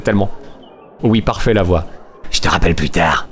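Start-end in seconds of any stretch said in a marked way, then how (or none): 2.3-3.3 lower, trucker-woman voice; heavy smoker voice. Acted. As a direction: play her like a chain-smoking lorry driver. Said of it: "une voix de camionneuse ou de grosse fumeuse".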